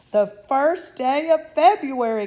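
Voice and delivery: loud, high excited cry; a sudden high excited cry. A narrator's reading. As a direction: blurt it out in alarm. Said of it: "cried Miss Bucket".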